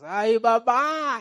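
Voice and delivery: mock cry of fear, high and drawn out; imitating scared men crying out, long rising wail; frightened scream. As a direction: wail for mama in hammed-up terror. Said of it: "empezaron a gritar".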